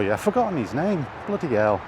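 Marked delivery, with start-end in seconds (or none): none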